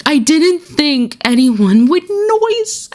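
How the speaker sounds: high, slow mock-innocent whine; exaggerated squeaky innocent voice; hammed-up mock sheepish. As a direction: play it as a silly, guilty excuse. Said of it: "Every time I read that quote".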